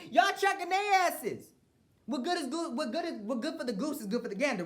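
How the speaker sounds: climbing outraged squeal; shouted peak of rant. Real, not acted. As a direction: hit the top of the rant.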